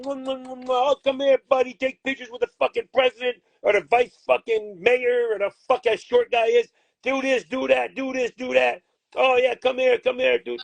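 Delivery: high, sugary mock fawning with kiss noises; mocking fawning kisses. Manager mocking officials cozying up to a fighter, high fawning voice. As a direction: ham up a sycophant cooing over his buddy.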